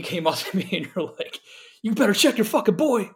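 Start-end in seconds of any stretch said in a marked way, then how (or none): 1.8-3.0 mock-irate, higher and louder; imitated angry warning. Bandmate retelling how a friend confronted him after the set, mock-irate.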